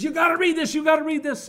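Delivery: high, loud, breathless excitement; excited sister. Retold or imitated. Sister bursting into the editor's office, excited. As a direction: burst in thrilled and urgent. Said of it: "she's all excited".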